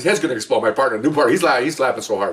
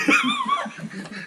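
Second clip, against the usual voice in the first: long, high cackling laugh; helpless laughter; 6 dB quieter than usual. Real, not acted. Storyteller breaking up at the coworker's question, helpless laughter. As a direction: crack up completely at the punchline. Cracks up at the punchline.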